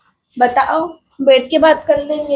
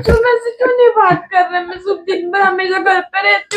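high fast tearful outburst; crying; 3 semitones higher than usual. Real, not acted. Wife crying as husband keeps ignoring her. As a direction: blurt it out sobbing. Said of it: "जितना तू रोने लग गई आज".

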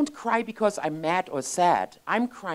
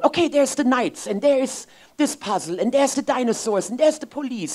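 put-on excited kids listing; 6 semitones higher, 3 dB louder than usual. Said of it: "where they just keep going".

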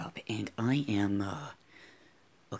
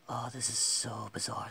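normal talking voice

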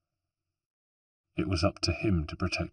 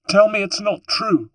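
high, loud, desperate cry; anguished plea; 14 semitones higher, 10 dB louder than usual. Acted. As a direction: beg loudly for it to be untrue. Sister begs for denial.

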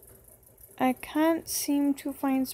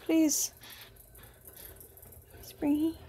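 ordinary talk, nothing marked

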